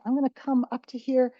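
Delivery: ordinary talk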